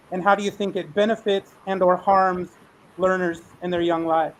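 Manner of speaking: ordinary delivery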